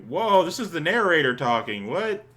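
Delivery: real startled aside. Real, not acted. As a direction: catch yourself off guard mid-reading. Startled by narration text.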